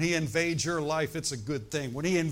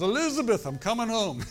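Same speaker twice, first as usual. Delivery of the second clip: much higher, louder mock heart-attack cry; Fred Sanford impression; 7 semitones higher, 6 dB louder than usual. Preacher imitating the sitcom dad's fake heart attack. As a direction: clutch your chest and holler it comically. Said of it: "he goes".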